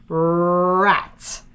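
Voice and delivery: "rat" with the R drawn right out; slow word. Shown as a demonstration. Teacher naming the first sound, "rat" stretched out slowly. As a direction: hold the R of "rat" before finishing. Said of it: "I'm gonna say it slowly".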